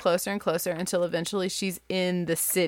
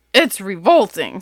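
louder, disgusted dad; disgusted Father Goat; 9 dB louder than usual. Acted. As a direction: spit out the verdict. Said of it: "said Father Goat".

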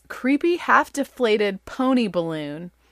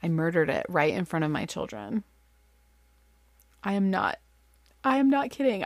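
ordinary delivery

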